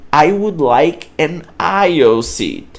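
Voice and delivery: I would like an aisle seat, slow and careful, aisle as one syllable; slow teacher demo; slow model sentence. Shown as a demonstration. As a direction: model it slowly for the class. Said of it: "essa forma que eu utilizo".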